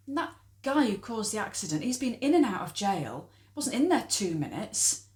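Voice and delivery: fast, loud and indignant; indignant rant. Acted. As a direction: fume about the unfair jail time. Ranting about the accident driver.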